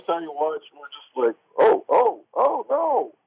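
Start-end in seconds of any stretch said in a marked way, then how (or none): 1.6-3.1 high-pitched string of worried ohs; mock dismay. Fans watching the game go wrong, high mock dismay. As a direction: play a watching fan's rising alarm, pitched way up. Acting out viewers' dismay at the match.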